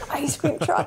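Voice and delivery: a pained echo; dismayed disbelief. Retold or imitated. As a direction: repeat it as if it's the worst news. Dread at hearing ice cream truck.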